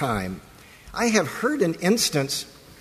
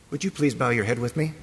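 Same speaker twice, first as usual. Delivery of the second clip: normal talking voice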